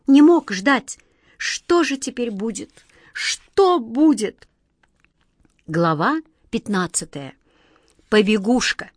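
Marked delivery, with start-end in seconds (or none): 0.1-4.5 raised, anguished questioning; troubled, helpless wondering; despairing worry. A narrator's reading. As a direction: fret aloud, stretching the last question high. Despairing wizard worries what comes next.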